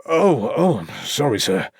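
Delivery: jolted awake with a flustered yelp; startled. A narrator's reading. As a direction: caught asleep, scramble to apologise. Startled sleepy policeman apologising.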